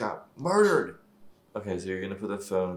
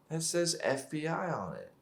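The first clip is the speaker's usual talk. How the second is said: ordinary delivery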